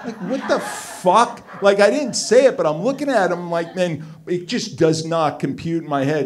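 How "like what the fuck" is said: disbelieving laugh, higher and louder; laughing disbelief. Real, not acted. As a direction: laugh it out in shock. Shocked at [name]'s khakis.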